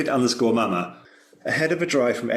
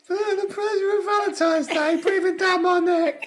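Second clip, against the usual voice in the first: very high-pitched mock voice of the co-host; co-host impression. Teasing co-host who asked to hear their voice, squeaky impression. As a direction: exaggerate a friend's voice, pushed way up high. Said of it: "Go on, do my voice".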